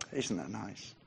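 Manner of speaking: very soft and low; quiet mock approval. Listeners underwhelmed by the answer, murmuring it quietly. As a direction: drop to a small polite murmur. Imagines congregation's underwhelmed, polite response.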